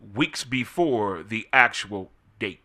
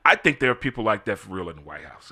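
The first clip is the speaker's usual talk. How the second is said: ordinary talk, nothing marked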